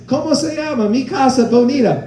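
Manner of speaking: high mock Spanish lines. Comic imitating [name]'s Spanish, high mock delivery. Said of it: "And he goes".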